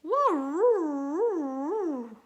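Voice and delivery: long baying dog cry; dog howl. Acted. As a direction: drag out a mournful dog howl. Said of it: "George cried".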